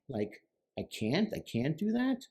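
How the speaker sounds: "I can't" repeated, climbing into a question. Shown as a demonstration. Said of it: "put each one of those as a question mark".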